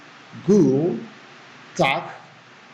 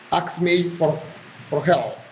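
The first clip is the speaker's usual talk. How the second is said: ordinary talk, nothing marked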